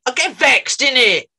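put-on high, loud teen street voice; a high, loud London street-slang teen; imitating the lad. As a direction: mimic a London teen brushing it off. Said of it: "he went".

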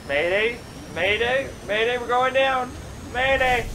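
high, loud panicked distress cry; frantic mayday call. Pretend. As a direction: yell it into a dying radio. Plane going down, distress call.

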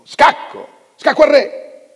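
piercing high scream of triumph; shouting. A narrator's reading. Player suddenly screaming check across the board, piercing shout. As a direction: yell it so everyone jumps. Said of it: "gridò".

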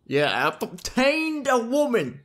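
high, slow, hammed-up gloating; mock triumph. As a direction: crow it slowly like a prize won. Jokingly gloats over new character.

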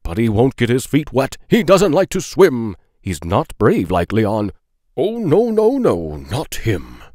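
ordinary delivery, nothing marked

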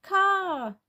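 high, drawn-out single word; high little toddler character. Acted. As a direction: say it like a tiny child. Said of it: "says Nicky".

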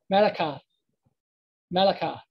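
"malakah" ending on an open ah. Shown as a demonstration. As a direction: end "malakah" on a clear ah. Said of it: "the I became an ah sound".

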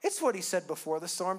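usual speaking voice

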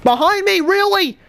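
very high, loud really; stunned and annoyed; killed from behind again; outraged disbelief. Real, not acted.

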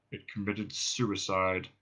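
low, slow, soft drone; depressed robot. Acted. Marvin tells Ford the ship's fate, morose drone. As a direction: deliver it in hopeless monotone.

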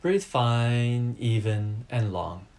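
ordinary talk

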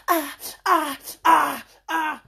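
wailing ah ah in a put-on voice; mock wailing. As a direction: mimic a man crying out. Mocking a crying man.

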